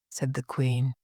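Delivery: ordinary delivery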